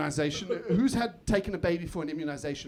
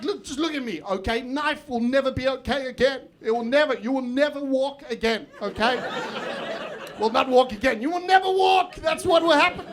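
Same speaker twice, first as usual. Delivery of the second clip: put-on high, loud panicky reassurance to a baby; mock panic; 6 semitones higher, 3 dB louder than usual. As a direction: babble wild doom at the crying baby. Telling baby it won't walk.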